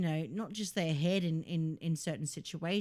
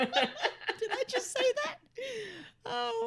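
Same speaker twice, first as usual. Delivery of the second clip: high, slow laughing question; laughing in disbelief; slower than usual. Real, not acted. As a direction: laugh through the words, embarrassed. Shocked at what she said live.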